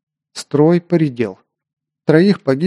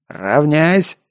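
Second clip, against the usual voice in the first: barked command; 3 semitones higher than usual. Acted. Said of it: "рявкнул сержант".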